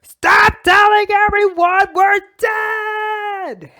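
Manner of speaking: high, loud, slow shout; yelling. Acted. Gamora and Loki yelling at Star-Lord, high and slow. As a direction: roar it out in outrage. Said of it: "Gamora and Loki yelling".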